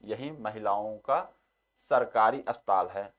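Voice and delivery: each word set apart slowly, as if for notes; slow, deliberate teacher's dictation. Shown as a demonstration. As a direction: spell out the corrected sentence slowly for students. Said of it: "इसका शुद्ध word क्या हो जाएगा".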